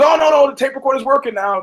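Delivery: high fast flustered babble; mocking a flustered journalist; mock flustered excuse. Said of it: "He goes".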